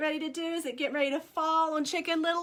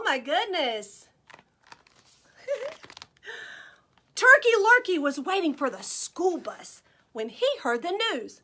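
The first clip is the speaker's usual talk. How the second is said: ordinary delivery, nothing marked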